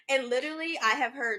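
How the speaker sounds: usual speaking voice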